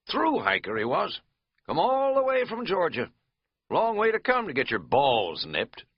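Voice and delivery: a hint of a country host; easy backwoods storyteller; lightly voiced innkeeper. Innkeeper finishing dog story.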